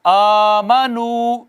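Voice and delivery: والذین آمنو chanted very slowly, long vowels held; teacher's recite-after-me chant; slow recitation. Shown as a demonstration. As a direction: recite slowly for students to repeat. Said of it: "میرے بعد یعنی کہ آپ کو پڑھنا ہوگا".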